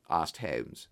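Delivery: ordinary delivery, nothing marked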